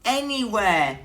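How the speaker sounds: high, loud squeaky complaint; high mouse squeak, let down. Acted. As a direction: squeak out the disappointment. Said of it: "squeaked Mouse".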